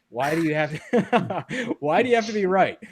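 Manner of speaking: giggling mock protest; laughing exasperation. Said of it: "And then eventually I'm like".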